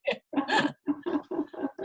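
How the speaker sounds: soft, airy trailing laugh; real laughter. Real, not acted. Guest amused by the cat's entrance, softly laughing. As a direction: laugh quietly, voice mostly gone.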